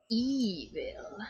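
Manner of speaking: hushed ominous whisper; whispered evil. Acted. Magic man warning the child, ominous whisper. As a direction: whisper the word like a dark secret. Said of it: "And the magic man goes".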